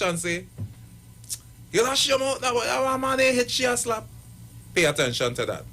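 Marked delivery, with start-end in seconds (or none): 1.7-4.1 high-pitched dismissive retort; acted telling-off, pitched up; man scolding at TV. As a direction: voice a man telling the screen to hush. Voicing man's reaction to TV.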